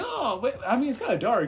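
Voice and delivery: high, laughing as the dog; sheepish dog, high and laughing. Retold or imitated. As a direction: play the sheepish dog through giggles. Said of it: "he was like".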